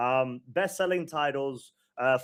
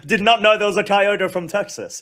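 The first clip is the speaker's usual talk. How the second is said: laughing surprise; 6 semitones higher, 8 dB louder than usual. Real, not acted.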